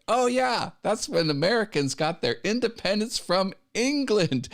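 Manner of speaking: high, bright sarcastic quote; fake-innocent jab at the English; mock cheerful self. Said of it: "I was like".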